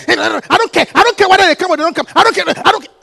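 hammed-up grumbling member; imitating an annoyed member. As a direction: act out a sulky church member who won't come. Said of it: "It's about".

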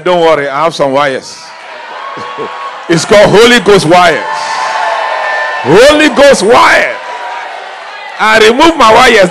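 shouted, high-pitched preaching proclamation; a preacher triumphantly announcing the cure; loud preaching. Real, not acted. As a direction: proclaim it at the top of your voice. Preaching climax about Holy Ghost.

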